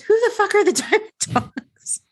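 high, indignant mock outburst; incredulous outburst. Podcast host quoting an indignant character, high incredulous outburst. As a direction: play up the disbelief as you quote him.

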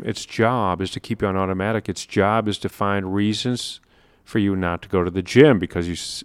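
ordinary talk, nothing marked

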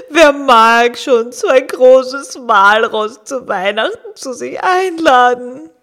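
gloomy walrus, close to tears; a big, self-pitying walrus; sad walrus voice. Acted. Walrus laments. (trauriges Walross)

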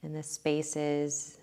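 calm body-scan voice with long pauses; guided meditation. Real, not acted. Meditation teacher talking listeners through breath and body scan, guided meditation.

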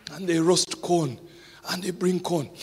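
ordinary delivery

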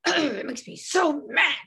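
hammed-up pouting child; a put-on tearful kid voice; mock sulky kid. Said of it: "And I'm gonna go".

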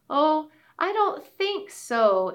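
a worried frog saying no. Acted. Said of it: "said Frog from the Bog".

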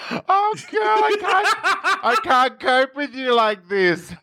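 high, helpless laughing wail; cracking up. Real, not acted. Co-host can't cope with the Scouse intro, cracking up. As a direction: barely get it out through high laughter.

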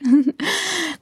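high giggling burst; real laugh. Real, not acted. Host amused at her own bank account aside, real laugh. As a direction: laugh at yourself, pitched high.

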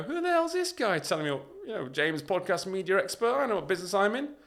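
high, loud mock outrage; indignant scoff. Audience member scoffing at the speaker, indignant and loud.

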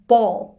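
"Ball" said loud with an open "ah" vowel; teacher demonstrates the "ah" vowel of "Ball", the thrown one. Shown as a demonstration. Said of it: "ボールの母音はO".

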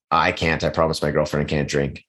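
ordinary delivery

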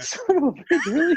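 very high, incredulous retort; mock exasperation. Player told he can't use that putter, mock exasperated. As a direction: squawk it in comic protest.

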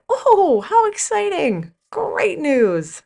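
high, bright-eyed mock gush; gushing salesman. Salesman gushing over the car deal, high mock excitement.

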